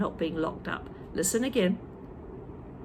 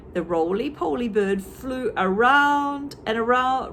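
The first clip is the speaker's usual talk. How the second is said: plain reading voice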